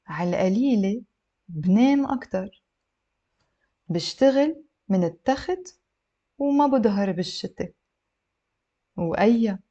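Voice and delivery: phrase by phrase, long pauses; slow model reading for learners; a little slow. Shown as a demonstration. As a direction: leave space after each chunk. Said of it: "a little bit slow".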